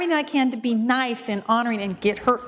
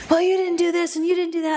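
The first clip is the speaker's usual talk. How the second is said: high-pitched mock accusation; retold indignant nagging; getting angrier; 7 semitones higher, faster than usual. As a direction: imitate an angry woman listing grievances. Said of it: "she was just getting more and more angry".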